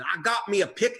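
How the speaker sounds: mocking Southern good-ol'-boy voice; mock Southern hayseed accent. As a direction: parody a politician putting on a country accent. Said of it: "I make fun of his accent".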